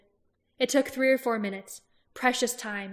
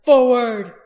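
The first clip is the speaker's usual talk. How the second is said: loud battle cry; roaring forward to his troops; shouted charge; 2 semitones higher, 16 dB louder than usual. Acted. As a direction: yell the charge. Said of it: "Roran shouted".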